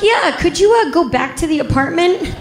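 high, hesitant, rising plea; awkward request. Retold or imitated. EMT asking officer to find a lost eye, awkwardly tentative. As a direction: ask the unpleasant favor gingerly, pitch way up.

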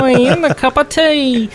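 hammed-up Cockney British accent; high, hammy British accent. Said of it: "sound British".

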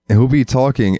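ordinary delivery

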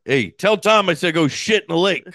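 put-on loud, high bark of an old man; mean old dad. Relaying the old man's message on that pod, a loud mean dad holler. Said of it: "he's like everyone's mean dad".

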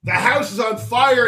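ordinary talk, nothing marked

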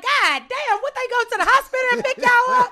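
loud, high and laughing in disbelief; a high, loud, amused outburst; exclaiming guard. Retold or imitated. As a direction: blurt it out, cracking up. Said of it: "She's like".

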